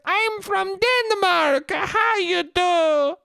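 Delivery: very high, slow fake Danish falsetto; bad Danish accent. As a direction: stretch every word in a silly Danish squeal. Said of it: "I would do that accent".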